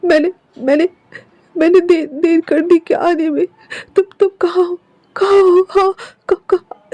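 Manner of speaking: crying out the name, breaking down; sobbing calls. Acted. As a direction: call the name through tears. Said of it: "और कहती है".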